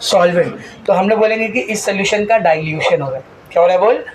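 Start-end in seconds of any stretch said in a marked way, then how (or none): none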